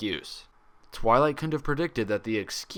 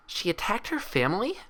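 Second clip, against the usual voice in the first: much higher, breathy disbelief; shocked gasp question; 12 semitones higher than usual. Acted. Twilight horrified at the story, high shocked question. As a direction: recoil in shock as you ask.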